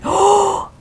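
agudo grito ahogado, sostenido; jadeo conmovido. Retold or imitated. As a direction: toma aire sonoramente, sobrecogida. Revive la emoción del agradecimiento. (exclamación aguda y conmovida)

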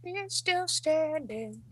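mock dramatic echo. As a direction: drag out "He's still standing" in a put-on voice. Player echoes in put-on voice.

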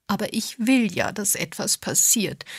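mild inward whine; a touch of plaintive complaint, held in; silent wailing. A narrator's reading. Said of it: "jammerte Agatha im Stillen".

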